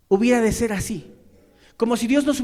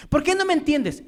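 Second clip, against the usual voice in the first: higher, accusing question; demanding reproach; 7 semitones higher, 3 dB louder than usual. Retold or imitated. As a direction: push the complaint hard. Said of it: "demanda algo que no hace".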